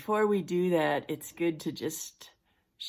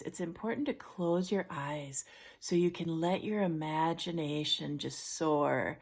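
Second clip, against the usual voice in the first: usual speaking voice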